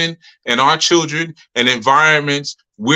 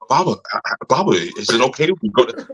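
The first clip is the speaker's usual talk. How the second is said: put-on kids' proper talk; 3 semitones lower, faster than usual. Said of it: "that's how they talk".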